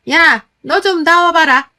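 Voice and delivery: loud, high, drawn-out summons; scolding shout. Acted. Mother-in-law calling daughter-in-law out to the yard, scolding shout. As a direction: holler for someone to come out at once. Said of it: "어머님의 호통소리에".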